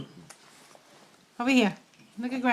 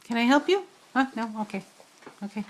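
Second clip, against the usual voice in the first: ordinary delivery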